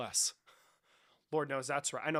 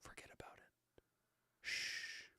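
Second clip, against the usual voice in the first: soft whispered shush; breathy hushing whisper; hushed shushing; 17 dB quieter, slower than usual. Acted. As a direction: hush them like a hypnotist. Shushing chat mockingly.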